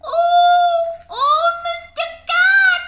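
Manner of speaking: squeaky pleading mouse. Acted. Mouse puppet begging the cat not to eat it, squeaky. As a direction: squeak for your life.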